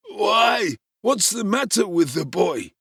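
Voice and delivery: put-on high, loud startled old man; startled old pauper. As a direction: recoil in surprise at the boy.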